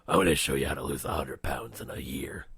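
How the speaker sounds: low, loud whispery growl; Batman. Retold or imitated. Dressed-up Batman promising a weight-loss lesson, deep rasp. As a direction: rasp it deep, forceful and grim. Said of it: "what's your advice, Batman?".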